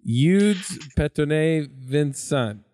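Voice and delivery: "Eudes Petonnet Vincent" very slowly, Americanized as yoodz pet-oh-nay vin-sun; halting American attempt at "Eudes Petonnet Vincent", vin-sun at the end; attempted French name. Real, not acted. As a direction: sound out "Eudes Petonnet Vincent" carefully with English vowels. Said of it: "Yeah, pretty close".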